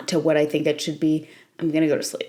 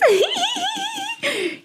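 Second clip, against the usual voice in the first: high giggle. Real, not acted. Laugh after tangent.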